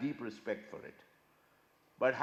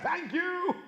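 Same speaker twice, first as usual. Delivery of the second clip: chirpy thanks; 5 dB louder than usual. Retold or imitated. Mock chirpy reply.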